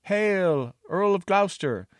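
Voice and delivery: put-on young yeoman calling down to the earl. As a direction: hail the earl across the gatehouse. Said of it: "a young spot-faced yeoman called".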